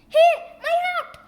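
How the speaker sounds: high, loud, fast outburst; startled cry. Acted. Mayor crying out as Dogman grabs his hat.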